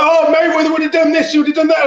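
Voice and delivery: lightly voiced pundit parody; mocking pundit. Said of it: "It's like".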